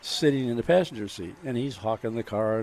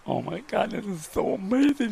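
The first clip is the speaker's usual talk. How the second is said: thrilled buyer; 7 semitones higher than usual. Retold or imitated. Buyer thrilled after the kiss.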